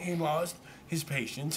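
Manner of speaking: normal talking voice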